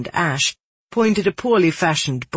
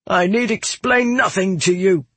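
growled; 4 semitones higher than usual. A narrator's reading. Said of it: "I growled at the man".

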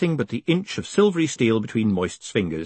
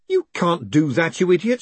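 exasperated scolding; 7 semitones higher, 4 dB louder than usual. A narrator's reading. Moist scolds the golem.

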